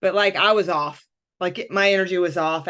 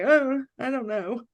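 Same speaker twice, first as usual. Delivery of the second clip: mopey texting; 3 semitones higher than usual. Retold or imitated. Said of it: "I'm like".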